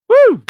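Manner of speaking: very high, loud celebratory woo; party-host cheer; cheering whoop. Real, not acted. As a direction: let out a crowd-pumping whoop. Cheer after guest intro.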